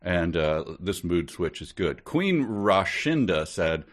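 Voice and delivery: ordinary talk, nothing marked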